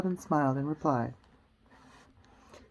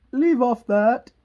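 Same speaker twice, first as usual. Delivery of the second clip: high, loud, drawn-out scream; screaming Queen; 6 semitones higher, 9 dB louder, slower than usual. Acted. Queen halting the bowing, screamed. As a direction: scream the order imperiously. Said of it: "screamed the Queen".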